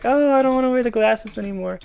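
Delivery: put-on high, loud girly whine; girl voice. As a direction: pitch it up like a disappointed girl. Girl regrets seeing ugly jock.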